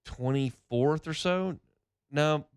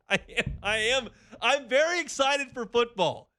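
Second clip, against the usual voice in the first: mock excitement; 11 semitones higher than usual. Host defending his offseason enthusiasm to listeners, jokingly excited. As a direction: insist on your excitement, laughing at yourself. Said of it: "you can sense the excitement in my voice".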